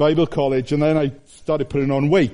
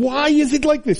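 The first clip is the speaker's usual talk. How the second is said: anguished self-question; 12 semitones higher than usual. Retold or imitated. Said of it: "all the time you think".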